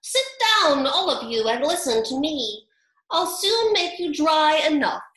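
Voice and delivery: high, loud commanding squeak; mouse calling out. A narrator's reading. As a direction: call them to order from the middle. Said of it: "called out".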